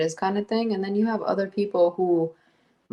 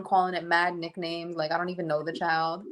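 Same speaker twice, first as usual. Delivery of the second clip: ordinary talk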